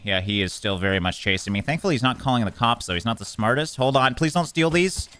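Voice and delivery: normal talking voice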